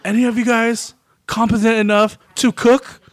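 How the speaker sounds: high, loud challenge to the staff; scary boss. Retold or imitated. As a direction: call out sharply, retelling a kitchen rant.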